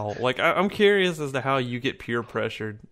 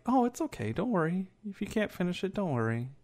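high, soft fake-sweet coaxing; soft, sugary mock comfort to a drinker; mock sweet reassurance; 7 semitones higher, 7 dB quieter than usual. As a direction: coo it like a patronizing friend. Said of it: "so kinda like".

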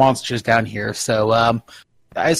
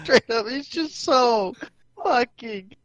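whiny high voice; 9 semitones higher, 4 dB quieter, much slower than usual. Acted. Mocking Ghulam's looks.

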